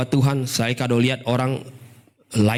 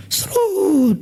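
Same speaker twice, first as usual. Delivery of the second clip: high drawn-out sliding noise; slide whoosh; 3 dB louder than usual. Retold or imitated. Preacher mimics believer suddenly sliding away, high whoosh. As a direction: imitate a sudden slip down the slide.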